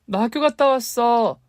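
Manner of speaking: put-on high bright child voice calling out; young girl calling for her mother; child calling mom. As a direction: call out like a little girl home from school. Young daughter calling home.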